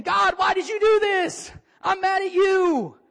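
high, slow, loud complaint to God; hammed-up Jesus yelling at the sky; Jesus mock complaint. Preacher acts Jesus shouting up at God, mock angry. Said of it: "This verse should read, Jesus saying".

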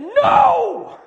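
loud, high yell of dismay. Retold or imitated. Preacher retells losing his photo to his wife's call, yelled. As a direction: let out a big, high "no". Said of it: "She just hears me yell".

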